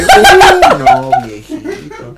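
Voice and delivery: high, slow laughing groan; laughing disbelief. Real, not acted. Mocking the bus driver's rude words, a laughing groan.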